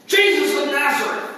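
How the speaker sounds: forceful, commanding centurion call; big Roman centurion. Acted. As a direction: announce it like a big soldier in charge. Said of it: "this big old Roman centurion".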